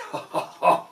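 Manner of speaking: loud hearty monster laugh. Acted. Gruffalo laughing at the little mouse, hearty. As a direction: laugh like a big amused beast. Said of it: "bursting with laughter".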